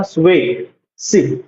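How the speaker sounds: स्वेसिव drawn out slowly so the w sound is heard; teacher's slow model of स्वेसिव; slow clear demo. Shown as a demonstration. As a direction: stretch स्वेसिव slowly for students. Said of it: "वे का sound आ रहा है ना".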